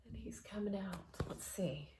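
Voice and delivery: hush, he's escaping; excited whisper. Real, not acted. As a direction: whisper it, full of suspense. Tense moment, cat escaping.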